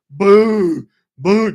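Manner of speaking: loud, booming "boo" thuds; imitated stomping. Giant dinosaur stomping past dad, booming imitation.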